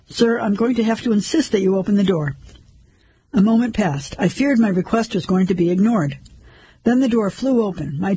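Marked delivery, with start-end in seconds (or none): none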